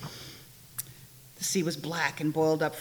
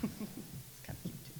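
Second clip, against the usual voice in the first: whispered aside; 3 semitones higher, 14 dB quieter than usual. Real, not acted. Reader whispers her verdict on the story to the congregation. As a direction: breathe the afterthought to the room.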